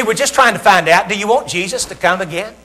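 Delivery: ordinary talk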